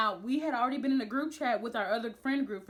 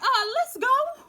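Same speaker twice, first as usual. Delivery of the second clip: high, loud, laughing urge to leave; laughing let's go; 12 semitones higher, 8 dB louder, slower than usual. Retold or imitated. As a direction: laugh it out, desperate to get away. Laughing nervous exit.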